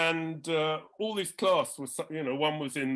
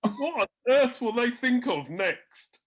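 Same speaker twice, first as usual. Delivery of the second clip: higher, louder outraged wonder; scandalised amazement; 6 semitones higher, 5 dB louder than usual. Retold or imitated. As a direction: marvel in horror. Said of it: "shakes his head and goes".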